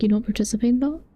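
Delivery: ordinary talk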